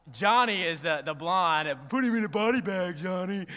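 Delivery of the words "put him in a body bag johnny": mock movie taunt. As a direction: jeer it like a movie henchman. Quotes Cobra Kai taunt from film.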